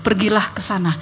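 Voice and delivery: slow, measured voice of God; loving, authoritative. Retold or imitated. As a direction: speak deliberately, as a father guiding his child. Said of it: "penuh kasih, berotoritas".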